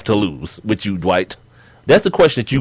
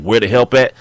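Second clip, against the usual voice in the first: ordinary delivery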